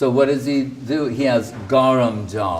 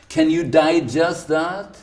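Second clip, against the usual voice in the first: ordinary talk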